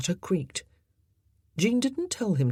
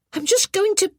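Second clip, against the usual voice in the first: put-on boy voice; 6 dB louder than usual. A narrator's reading.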